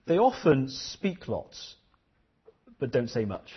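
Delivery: slow, weighty teacher's line; a deliberate, measured saying; slow teacher quote. Retold or imitated. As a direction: let each word land slowly. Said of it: "She said".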